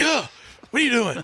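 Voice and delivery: mock startled gasp, voice jumping up. Dad waking to his kid standing over the bed, startled.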